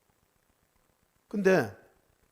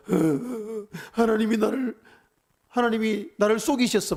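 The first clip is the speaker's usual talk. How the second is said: high, loud choked wail, higher and louder; imitated sobbing; 6 semitones higher, 5 dB louder, slower than usual. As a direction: retell the crying fit, moaning then blurting the accusation. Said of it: "엉엉 울면서". (엉엉 우는 소리를 흉내 내며 하는 하소연)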